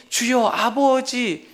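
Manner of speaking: very slow, stretched 주여, 아버지; drawn-out call. Shown as a demonstration. Pastor modelling the simple prayer, slow drawn-out call. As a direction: draw each word out like a prayer cry. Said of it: "그 이름만 반복해서 부르짖으며 기도해 보십시오".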